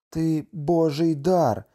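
slow, high-pitched exclamation; exclaiming. A narrator's reading. Husband hails his wife as God's gift, exclaiming. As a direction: cry it out with fervour, drawing the words out. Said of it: "вигукнув Кеаве".

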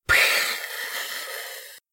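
soft breathy cat hiss. A narrator's reading. Cat disgusted by the bed, soft hiss. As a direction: spit out a tiny hiss. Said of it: "прошипела кошка".

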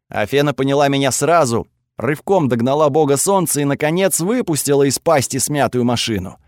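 ordinary delivery

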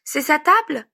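put-on eager kid's voice; small child's voice. A narrator's reading. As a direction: ask like a curious small child. Child asks in story.

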